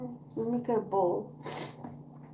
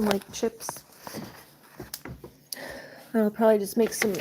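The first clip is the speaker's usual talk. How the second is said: normal talking voice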